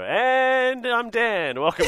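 high, loud, very slow announcer intro; radio host voice. Retold or imitated. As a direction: roll out the welcome like a radio host. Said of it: "I'll just go".

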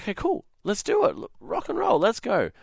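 high-pitched, eager go-ahead; excited agreement. Retold or imitated. Buyer retelling his reply to the seller, excited. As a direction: agree keenly, pitch jumping well up.

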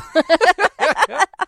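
loud laugh up high; real loud laugh. Real, not acted. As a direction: burst out laughing. Laughing at listener text.

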